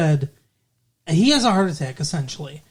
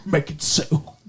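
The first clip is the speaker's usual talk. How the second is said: put-on English-accented starship captain; crisp English accent for a captain's order; Jean-Luc Picard; 2 semitones lower than usual. Host mocking a Frenchman played with an English accent. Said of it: "and he speaks like this".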